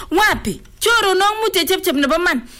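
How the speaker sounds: normal talking voice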